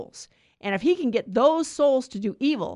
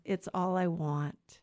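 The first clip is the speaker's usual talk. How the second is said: tender Jesus; 4 semitones lower, 6 dB quieter, slower than usual. Acted.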